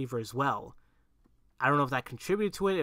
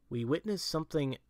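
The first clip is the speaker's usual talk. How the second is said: ordinary talk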